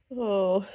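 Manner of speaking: sudden sneeze; loud sneeze. Real, not acted.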